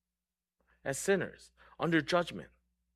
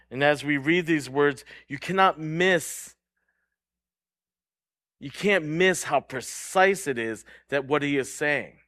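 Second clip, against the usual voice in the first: usual speaking voice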